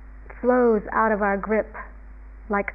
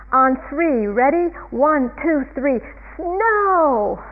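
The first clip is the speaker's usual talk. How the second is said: loud, higher countdown building to a yell; yelling upward; 4 semitones higher, 4 dB louder, much slower than usual. Retold or imitated. Calvin commanding the heavens to snow, yelling. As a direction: count off, then holler up at the sky. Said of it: "he yells up at the".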